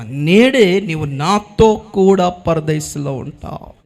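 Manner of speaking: slow, weighty, each word set down; slow solemn promise. Acted. Jesus promising the thief paradise, slow and solemn. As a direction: promise it slowly and grandly.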